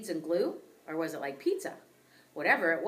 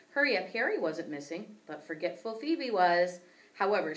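ordinary delivery, nothing marked

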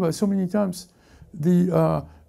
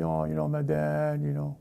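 put-on little baby talk; 6 dB quieter than usual. Preacher shows how a little baby speaks, mock childish voice. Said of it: "A little baby will speak like that".

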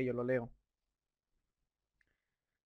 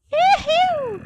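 big high whoop of joy; gleeful yujú; excited whoop; 12 dB louder than usual. Real, not acted. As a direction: celebrate out loud. Cheering while playing.

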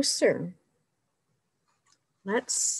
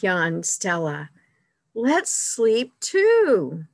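put-on lively read-aloud for children, higher and louder; story reading; 4 semitones higher, 5 dB louder than usual. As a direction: read it like a picture book to kids. Reads picture book aloud.

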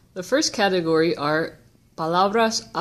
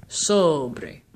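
sobre with a long SO, falling away on bre; stress on first syllable; 3 semitones lower than usual. Shown as a demonstration. Teacher showing where emphasis falls, first syllable of sobre stressed. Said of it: "emphasis is on the second to last syllable".

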